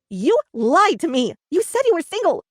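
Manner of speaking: loud furious yell, rising high; teacher screaming at lying boyfriend, furious; angry yell. A narrator's reading. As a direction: yell it in fury. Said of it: "yelled Miss Berry".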